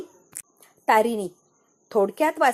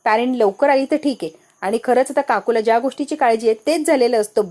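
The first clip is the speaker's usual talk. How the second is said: ordinary delivery, nothing marked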